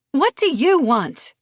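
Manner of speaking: slow, high, loud spit of fury; spitting anger. Acted. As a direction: spit the words at her. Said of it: "she spits".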